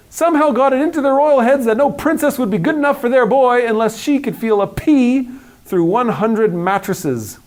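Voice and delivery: ordinary delivery, nothing marked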